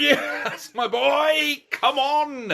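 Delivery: proud dad's imitated inner cheer, high and loud. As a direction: let the proud parent burst out. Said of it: "inside I'm going".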